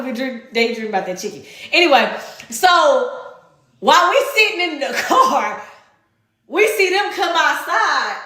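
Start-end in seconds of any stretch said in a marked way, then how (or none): none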